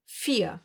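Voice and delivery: "vier" with an F and no R; German vier. Shown as a demonstration. Teacher shows V said as F. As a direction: say "vier" as fee-ah. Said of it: "Like here in this number".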